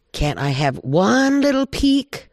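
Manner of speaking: high begging, "one" stretched out; pleading coyote. Acted. Coyote begs Badger for a look inside, high pleading. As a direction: wheedle with the voice soaring up.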